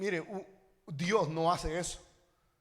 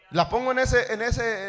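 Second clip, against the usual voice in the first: ordinary talk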